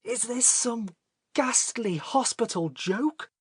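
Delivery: high, incredulous outrage; terror turned rage. A narrator's reading. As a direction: demand it slowly, aghast and furious. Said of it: "his terror resolving into rage".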